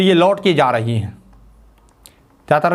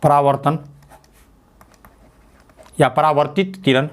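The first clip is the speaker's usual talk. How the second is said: dictation; 3 semitones lower than usual. Shown as a demonstration. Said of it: "तो लिख लीजिए".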